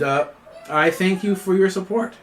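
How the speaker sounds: ordinary talk, nothing marked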